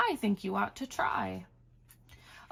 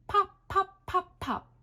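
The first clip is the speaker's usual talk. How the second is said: high, louder popping; cork pop; 10 semitones higher, 4 dB louder than usual. Acted. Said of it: "He can sound like a cork".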